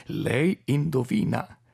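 put-on slow sly hint from old Orlik; Orlik's sly broken Italian. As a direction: tease him, slowly. Orlik teases.

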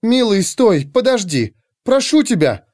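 high, anxious female plea; worried, high-voiced pleading; alarmed woman. A narrator's reading. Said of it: "донёсся встревоженный женский голос".

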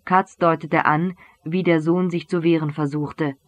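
ordinary delivery

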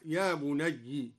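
slow, low "يا بني" ending on kasra, "بنيِّ". Shown as a demonstration. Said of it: "هكذا".

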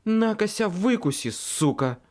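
злой выкрик, выше; дерзко бросает в лицо чудовищу; рявкнула. Acted. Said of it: "рявкнула Мёрфи". (злой рявк)

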